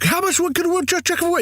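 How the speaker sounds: very high, goofy falsetto; silly babbler. Acted. Man spouts nonsense in a gun battle, silly babbler. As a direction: rattle it off as a squeaky, mock nonsense chant.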